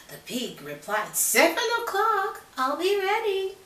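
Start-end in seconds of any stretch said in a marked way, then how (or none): 1.2-3.6 high, loud little pig. Acted. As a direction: chirp it eagerly. Pig replies.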